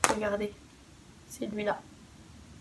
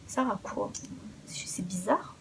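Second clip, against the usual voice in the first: whispered aside; 3 semitones lower, 14 dB quieter than usual. Real, not acted. Puzzled aside about the name.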